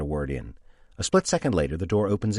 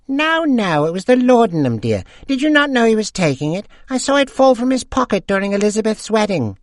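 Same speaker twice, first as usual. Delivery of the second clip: put-on much higher gossiping old woman voice; old lady; 7 dB louder than usual. A narrator's reading. Second old lady gossiping with friends, high voice. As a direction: chime in like a gossipy widow.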